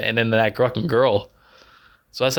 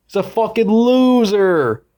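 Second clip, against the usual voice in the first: higher, slow scornful verdict; contemptuous; 8 semitones higher, 4 dB louder, slower than usual. Real, not acted. Mocking the movie hero, slow and scornful. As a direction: drag it out with disdain.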